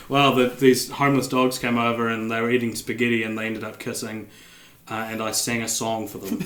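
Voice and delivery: low, soft sheepish mutter; quiet muttering. Retold or imitated. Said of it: "his wife then made it".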